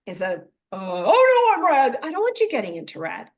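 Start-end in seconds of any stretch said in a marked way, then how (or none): 0.7-2.0 mock panic. Rider panicking in the red.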